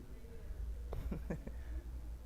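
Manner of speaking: a long breathy laugh under the breath; soft airy laugh. Real, not acted. Teasing the audience member Abi, a soft breathy laugh. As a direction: let out a quiet, breathy chuckle.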